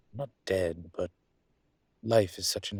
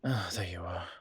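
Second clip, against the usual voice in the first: whisper. Acted. Professor spotting her visitor, a pleased whisper. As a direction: greet them under your breath.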